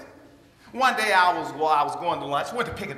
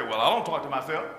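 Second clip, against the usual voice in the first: lower, half-laughing mock denial; little doubting inner voice; 7 semitones lower than usual. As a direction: play the sceptical little voice in your head. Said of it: "did a little voice cough in the back of your mind and say".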